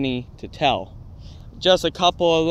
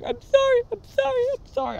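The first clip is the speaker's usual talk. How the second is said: panicked apology; faster than usual. Retold or imitated. Scared kid apologizing to crying teacher, high frantic pleading. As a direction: beg forgiveness in a squeaky panic. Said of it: "I am going".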